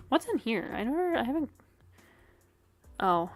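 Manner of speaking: usual speaking voice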